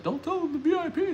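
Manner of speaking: squeaky high mock-wife voice; imitating wife. Wife telling him not to reveal the keyword, high-pitched imitation. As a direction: pitch way up to mimic your wife. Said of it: "My wife is like".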